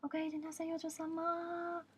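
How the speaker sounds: high, very slow sing-song welcome; drawn-out cutesy maid welcome; imitating maid greeting. A maid greeting her customer, high and slow. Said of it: "qui te disent".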